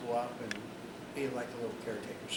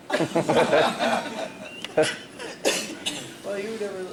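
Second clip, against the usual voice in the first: laughing; 5 semitones higher, 11 dB louder, much slower than usual. Real, not acted. Laughing at grandfather's trick.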